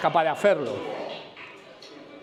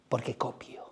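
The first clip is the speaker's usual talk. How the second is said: whispered punchline; 6 semitones lower, 11 dB quieter, slower than usual. Real, not acted. Punchline answer to own question.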